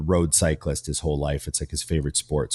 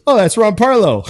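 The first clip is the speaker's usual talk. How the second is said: much higher, loud starstruck exclaim; excited recognition; 10 dB louder than usual. Retold or imitated. Gym trainer spotting a famous bodybuilder in a photo, loud excited surprise. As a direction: blurt the name in delighted surprise.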